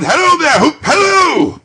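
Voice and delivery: high, loud calling out; joyful whoop. Acted. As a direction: whoop with delight. Scrooge whooping with joy.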